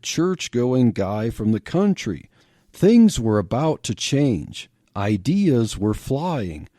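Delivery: ordinary delivery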